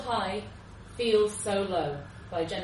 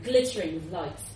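ordinary delivery

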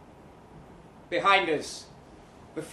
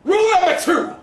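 much higher and louder, proclaiming; grand announcement; 11 dB louder, faster than usual. Acted. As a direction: boom it out like a title card. Narrator announces seduction rule.

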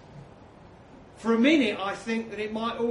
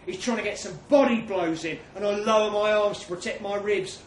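ordinary delivery

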